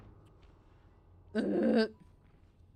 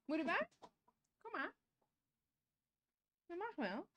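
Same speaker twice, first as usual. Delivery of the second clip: pet talk; 7 semitones higher, 3 dB quieter, faster than usual. Real, not acted. Owner coaxes the pet onto her lap, high cooing. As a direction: coo gently at the pet.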